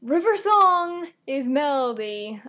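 loud, high, drawn-out announcement; big reveal. Real, not acted. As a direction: proclaim the spoiler slowly and loudly. Blurts out the big spoiler.

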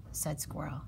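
plain reading voice

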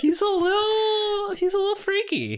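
squeaky giggling voice; high laughing squeal. Real, not acted. Laughing at drawing.

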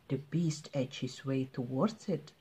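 hushed narrator whisper; cautious whisper. A narrator's reading. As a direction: creep the words out.

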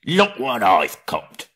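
loud, slow troll calling out to Bert; troll shouting over his catch; hammed-up loud troll cry; excited troll. A narrator's reading. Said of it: "said William".

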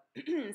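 sharp, loud throat clear; pointed throat clear. Acted. Teacher clears her throat at the girls, pointedly. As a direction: clear the throat to warn the class. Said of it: "then cleared her throat".

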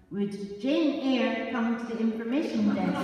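airport announcer's measured call; lightly voiced public address call; a hint of an airport tannoy voice; tannoy announcement. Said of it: "this message come over the tannoy".

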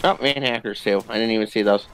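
ordinary talk

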